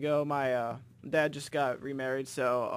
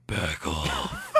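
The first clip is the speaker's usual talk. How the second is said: low, menacing creepy voice; 9 semitones lower, 3 dB louder than usual. Retold or imitated. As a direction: growl it like a creep. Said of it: "some really creepy voice".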